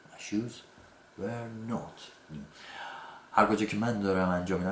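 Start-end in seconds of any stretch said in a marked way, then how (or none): 0.1-2.4 were not new very slowly and much softer; slow negated model. Shown as a demonstration. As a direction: stretch the not, drop the volume.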